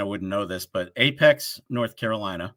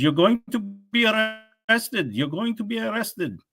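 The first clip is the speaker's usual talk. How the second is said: slow, much higher, put-on threatening caller; mock scammer threat; 10 semitones higher, slower than usual. Imitating the scam caller threatening him with arrest, slow and high. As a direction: drag it out like a cartoon scam caller menacing you.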